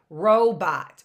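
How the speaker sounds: "Robot" in two distinct beats, loud on the first; two stops. Shown as a demonstration. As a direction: punch out "Robot" as ro, bot. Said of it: "Two stops".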